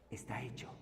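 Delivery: breathy whisper of "Está hecho", as if sharing a secret. Real, not acted. Preacher whispering the core truth to listeners. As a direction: drop to a whisper, almost no voice. Preacher hushes the key phrase.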